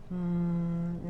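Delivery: soft steady hum on M; sustained hum. Shown as a demonstration. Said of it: "el humming, de la M". (tarareo sostenido de la M)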